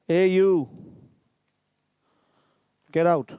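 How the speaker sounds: loud, high, blunt command; rude order. Shown as a demonstration. Teacher demos a rude order to leave, loud. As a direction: bark it at an intruder. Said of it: "which we give in formal way, informal way".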